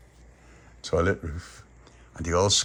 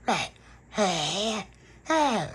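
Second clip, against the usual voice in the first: high eerie hey; vampire calls; 11 semitones higher, much slower than usual. Retold or imitated. Baby Lucia following, high eerie calls. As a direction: call out eerily. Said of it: "Like that".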